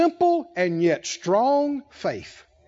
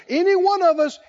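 ordinary talk, nothing marked

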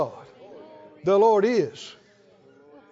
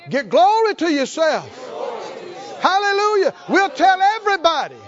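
full-force preaching; 8 semitones higher, 3 dB louder than usual. Acted. Preaching climax.

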